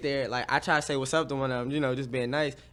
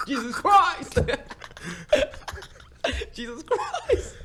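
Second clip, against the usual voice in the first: laughing exclamation; 12 semitones higher, much slower than usual. Real, not acted. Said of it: "laughing exclamation".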